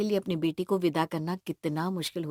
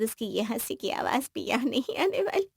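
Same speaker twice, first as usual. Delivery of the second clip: tearful; 3 dB quieter than usual. Acted. Mother dreads losing daughter's laughter, tearful. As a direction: voice starting to well up with tears. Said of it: "ऐसे रोइए मत आप लोग".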